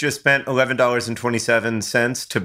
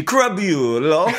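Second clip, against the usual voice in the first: slow, drawn-out mock Italian Crabulo; laughing Italian-accented Crabulo; Jared Leto Gucci accent; 4 semitones higher, much slower than usual. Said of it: "how Jared Leto would say it in the House of Gucci".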